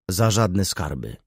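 ordinary delivery, nothing marked

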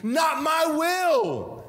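high, slow, drawn-out cry of NOT; anguished cry. Acted. Jesus's prayer, cried out.

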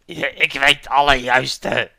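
slow, loud put-on boast; a hint of a boastful game character; lightly voiced bandit boast. Bandit claiming all the right answers, mock boast.

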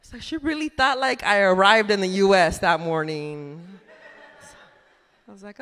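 ordinary talk, nothing marked

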